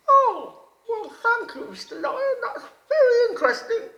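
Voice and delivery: high, loud, bright-eyed little frog voice; wide-mouthed frog voice. A narrator's reading. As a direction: squeak it out like an eager little frog. Frog thanks the lion.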